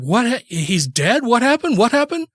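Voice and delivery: climbing high disbelieving what happened; stunned shock. Retold or imitated. Wife learning her uncle died, high stunned disbelief. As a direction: hit by the news, voice shooting up.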